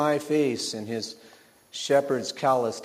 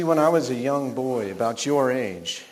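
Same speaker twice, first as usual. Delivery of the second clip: ordinary delivery, nothing marked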